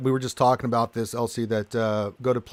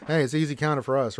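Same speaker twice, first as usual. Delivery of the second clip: usual speaking voice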